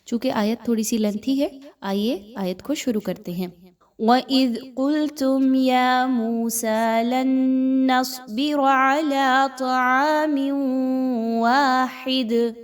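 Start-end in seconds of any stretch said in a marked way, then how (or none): 4.0-12.5 slow melodic tilawat, higher and louder; measured tajweed recitation; Quranic recitation. Acted. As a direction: chant the verse with long held vowels. Reciting the verse in full.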